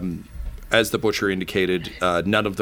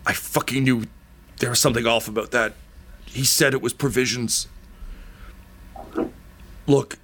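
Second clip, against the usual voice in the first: bitter dying man; 4 semitones higher than usual. Acted. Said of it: "and he's like".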